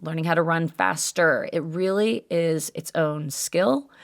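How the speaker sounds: ordinary delivery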